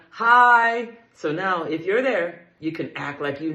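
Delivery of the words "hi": loud, high congregation reply; called response. Shown as a demonstration. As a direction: answer back as a crowd. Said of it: "everybody respond".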